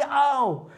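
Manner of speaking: high, drawn-out "ow"; pained yelp. Acted. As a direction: act out a sudden jab of pain. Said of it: "dia alas sakit". (mengaduh kesakitan)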